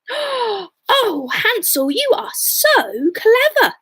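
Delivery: put-on high, slow, gushing girl voice; admiring girl. Gretel to Hansel, high and admiring. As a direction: gush over his cleverness.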